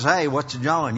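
imitated US Southern accent. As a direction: put on a casual Southern greeting. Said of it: "they're from the South somewhere".

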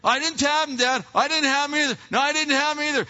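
lightly voiced children, high and loud; acted indignant denial; protesting kids. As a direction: deny it loudly, one child after another. Kids protesting to angry dad.

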